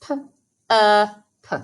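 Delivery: P-er-p split into separate sounds on fingers; segmenting phonemes. Shown as a demonstration. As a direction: sound out P-er-p, one sound per finger. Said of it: "Three Fred fingers".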